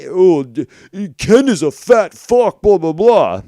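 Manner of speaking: loud, high mock insult; mocking Scott. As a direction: parody his ranting, loud and dismissive. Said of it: "as Scott will say".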